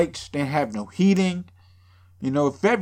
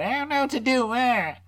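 mock scared virgin; 9 semitones higher than usual. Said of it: "virgin's probably scared".

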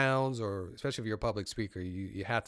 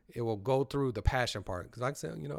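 ordinary delivery

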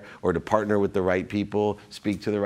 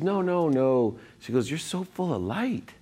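higher-pitched quoted doctor, lightly voiced; doctor's insistence; 6 semitones higher than usual. Doctor telling her seatmate he is full of light, insistent.

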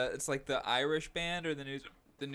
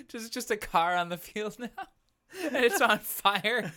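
laughing; 7 semitones higher, 5 dB louder than usual. Real, not acted. Laughing at the screen.

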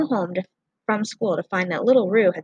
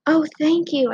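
relieved cry; 8 semitones higher, 4 dB louder than usual. A narrator's reading. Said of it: "I cried".

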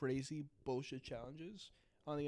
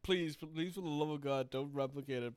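usual speaking voice

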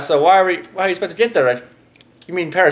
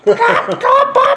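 chicken clucking; 5 dB louder than usual. Pretend. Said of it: "I have terrible chicken impressions".

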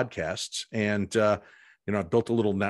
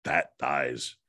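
low, deadpan machine voice; imitated voice-box output; electronic voice box; 8 semitones lower than usual. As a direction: drop low like a talking gadget. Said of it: "all of a sudden it goes".

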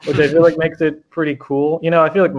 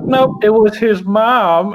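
mock smug correction; 8 semitones higher, 5 dB louder, much slower than usual. Quoting killer's smug correction.

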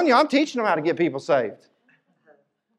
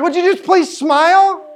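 high, loud, slow mock plea; pleading with the stern prayer ladies, exasperated; 11 semitones higher, 8 dB louder, slower than usual. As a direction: beg them to lighten up, hammed-up. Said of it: "I'm like".

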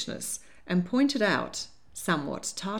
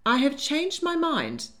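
declaring; 8 semitones higher, 3 dB louder than usual. A narrator's reading. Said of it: "declared April".

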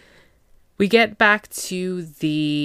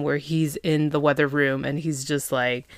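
ordinary talk, nothing marked

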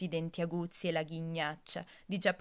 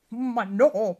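loud, higher indignant outburst; protesting wolf; 5 semitones higher, 13 dB louder than usual. Acted. Wolf protesting the girl's wrong line, loud and indignant. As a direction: burst out in offended protest. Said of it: "protesta il lupo".

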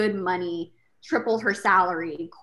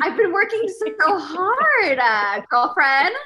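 higher, slow, louder whine; retelling her hurt reply to a mentor, hammed-up whine; mock whiny self-pity; 10 semitones higher, 8 dB louder, slower than usual. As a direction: whine it like a wounded diva. Mock self-pity after being told off.

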